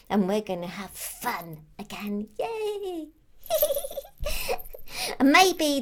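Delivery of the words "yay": a soft yay that breaks into a giggle; giggly cheer. Real, not acted. Elf celebrating the return to Lapland with a giggly yay. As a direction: cheer through a laugh.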